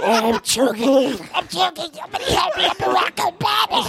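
choking Obama yelling. Pretend. Obama choking, calling for help.

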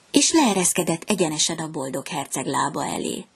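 plain reading voice

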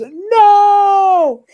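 high anguished yell; dramatic shout. Retold or imitated. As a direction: cry out in despair. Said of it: "when Obi-Wan, you could see it".

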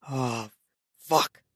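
low, grunted curse; deep grunt. A narrator's reading. Man pulling away, deep grunt. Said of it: "with a deep grunt".

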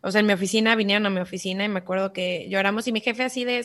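ordinary delivery

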